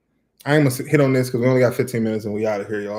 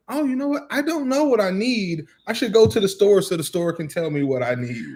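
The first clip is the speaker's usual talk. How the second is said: high sarcastic mimicry; mock high voice; 8 semitones higher than usual. Husband mocking the idea of store shopping, high sarcastic voice. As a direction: exaggerate it, way up high. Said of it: "I was like".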